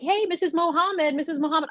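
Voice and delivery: high, singsong mock greeting; mimicked high-pitched hailing of a parent; calling out greeting. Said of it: "will always be calling me".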